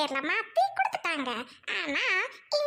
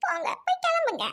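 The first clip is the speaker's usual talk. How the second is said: high, sobbing send-off; tearful, wounded dismissal; crying; 7 semitones higher than usual. Acted. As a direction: shoo him away through sobs. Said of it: "நீ சமத்து குட்டில அழாதம்மா".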